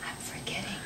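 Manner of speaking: soft whispered aside. Real, not acted. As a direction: whisper it off camera. Aside to other adult.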